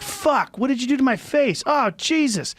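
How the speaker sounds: high-pitched mock cry of pain; agonized protest. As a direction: yelp it like a victim in agony. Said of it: "He's, like, in pain".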